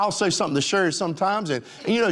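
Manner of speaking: ordinary talk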